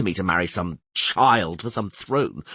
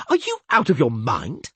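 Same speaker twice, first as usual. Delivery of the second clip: raised, choked disbelief; choking out; 6 semitones higher, 5 dB louder than usual. A narrator's reading. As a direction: force it out in shock. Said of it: "choked out the King of Temeria".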